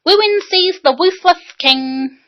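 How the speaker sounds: put-on high, slow boyish recitation; childish declaiming of the bard; lightly voiced boy starting the poem; boy reciting. A narrator's reading. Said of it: "the bard, beginning".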